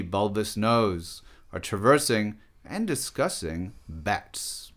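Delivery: measured, declaimed poem performance; poem reading. Acted. Artist performs a poem about philosophers, declaimed. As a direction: recite it with deliberate phrasing and pauses. Reads own poem aloud.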